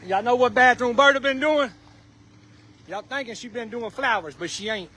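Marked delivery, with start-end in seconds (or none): 2.9-4.9 hushed gossip. Real, not acted. To the crowd about Bathroom Bertha, hushed and teasing. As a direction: lean in and dish quietly, much softer.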